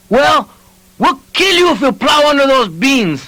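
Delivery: high, screaming threat. A narrator's reading. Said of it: "Sparky Pacheco fairly screamed".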